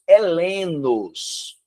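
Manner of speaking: "helenos" very slow and deliberate; teacher stresses "helenos" slowly for the class; slow emphasis. Shown as a demonstration. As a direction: spell out "helenos" as a key term. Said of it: "eles eram helenos".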